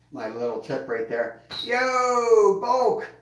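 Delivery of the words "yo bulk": high, loud Yo, Bulk called out; excited shout. Real, not acted. Greeting the donor on stream with an excited shout.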